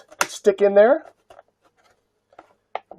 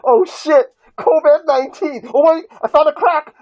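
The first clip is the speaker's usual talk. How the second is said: high, loud excited yelling; excited shouting; 9 semitones higher, 5 dB louder than usual. Real, not acted. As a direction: shout it out like a big discovery. Excited discovery of mask crack.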